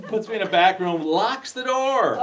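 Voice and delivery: hammed-up outrage for laughs; mock alarm. Real, not acted. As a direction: belt it out, stretching the final phrase. Comic telling of being shut in.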